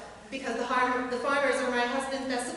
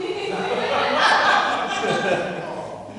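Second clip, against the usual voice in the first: loud breathy laugh; 7 dB louder than usual. Real, not acted. Laughing at own joke.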